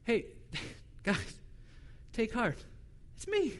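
soft, unbothered reassurance; easy, quiet calm; Jesus soothing his frightened disciples; cool and calm. Acted. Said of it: "he just plays it so cool".

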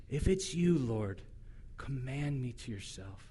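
soft, low, steady resolve; quiet laser-focused request. Acted. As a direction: Peter quietly and intently asking to come to him. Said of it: "just so focused, fixed, laser beam".

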